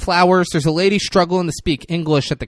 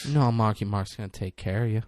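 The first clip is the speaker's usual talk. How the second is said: softly voiced celebrity impression; Mark Wahlberg; 3 semitones lower, 6 dB quieter than usual. Star promising to take care of his baby, lightly done impression. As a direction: lightly imitate a sweet-talking actor, softly. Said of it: "Kinda sound like Mark Wahlberg there".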